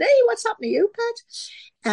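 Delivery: high-pitched Sunderland grandmother; high, broad north-eastern granny voice; Sunderland grandma. Retold or imitated. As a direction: imitate a startled grandma in Sunderland dialect. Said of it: "And my grandma said".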